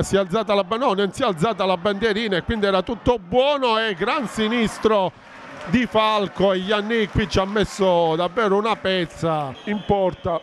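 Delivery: ordinary talk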